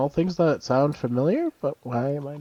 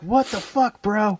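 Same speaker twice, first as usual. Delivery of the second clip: mock indignant bro; 8 semitones higher, slower than usual. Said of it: "They're like".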